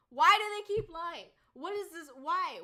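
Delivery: loud, high exasperated shout. Real, not acted. Ranting about liars, loud exasperated shout. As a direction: yell it out in disbelief.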